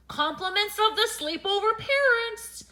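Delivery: high, loud delivery announcement; calling out. A narrator's reading. Stranger delivering a gift from the parents, calling out. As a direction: call it out grandly to the tent. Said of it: "called an unfamiliar voice".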